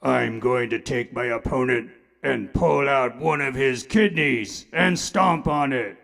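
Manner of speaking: calm, low reasonable wrestler voice; Reasonable Man. Acted. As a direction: say the violent threat very calmly. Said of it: "Mister Reasonable Man".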